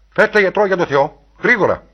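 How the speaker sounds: loud, high, pleading call for help; urgent shouting. A narrator's reading. Begging others to fetch a doctor.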